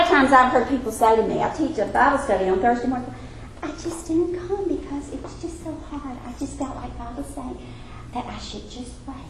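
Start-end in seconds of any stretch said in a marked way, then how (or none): 3.6-9.2 much softer, put-on limp voice of the excuse-maker; lightly mocking the excuse; mock whiny excuse.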